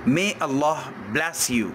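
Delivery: ordinary delivery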